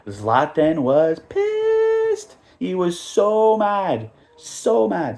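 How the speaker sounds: slow, loud and high, stretching every word; emphatic slow outrage. Real, not acted. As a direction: lean hard into how mad he was. Retelling Zlatan's anger.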